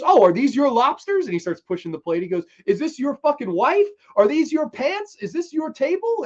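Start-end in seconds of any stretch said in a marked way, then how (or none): 0.0-1.3 high, loud rising question; mock taunting. Rival taunts in acted quarrel. 2.7-6.2 high, loud string of rising questions; mock taunting. Rival taunts while pushing plate.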